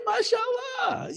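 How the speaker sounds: high, slow, drawn-out mashallah; shrugging off a bragger; mock blessing. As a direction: stretch out the blessing with a laugh.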